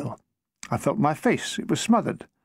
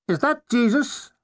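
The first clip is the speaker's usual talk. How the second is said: slow, high donnish caller; drawn-out academic inquiry, pitched up; 6 semitones higher, slower than usual. A narrator's reading. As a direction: play a don on the telephone, high and slow. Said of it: "said a Donish voice".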